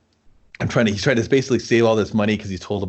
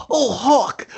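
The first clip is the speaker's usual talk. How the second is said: put-on high-pitched orphan kid voice; little orphan kid; 14 semitones higher than usual. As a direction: pipe up as an excited little orphan kid calling his hero. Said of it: "some little orphan kid runs up".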